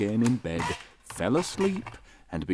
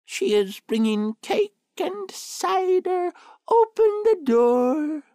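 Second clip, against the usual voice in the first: high, slow put-on girl voice, louder; wolf squeaking like a child; wolf faking girl; 5 dB louder, slower than usual. A narrator's reading. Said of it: "replied the wolf".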